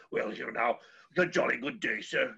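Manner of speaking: lightly voiced posh British professor accent; a hint of an old British don. Teacher demonstrating a sophisticated British professor accent. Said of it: "the old British professor".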